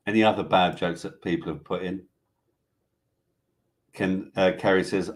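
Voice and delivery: usual speaking voice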